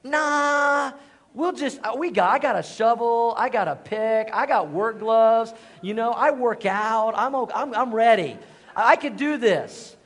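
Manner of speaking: cocky bravado. Retold or imitated. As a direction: puff yourself up like you can dig it all by hand. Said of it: "And I was like".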